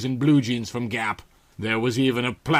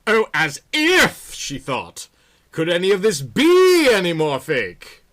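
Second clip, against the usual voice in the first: scornful inner thought; 6 semitones higher, 5 dB louder than usual. Acted.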